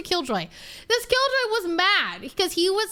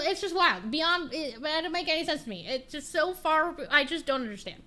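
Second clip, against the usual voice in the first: ordinary talk, nothing marked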